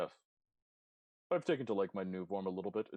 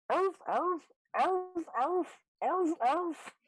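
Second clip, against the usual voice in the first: high, loud seal barking; sharp, high-pitched "arf" barks; 11 dB louder, much slower than usual. Acted. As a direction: bark loudly like an excited seal. Imitating the galumphing seal barking.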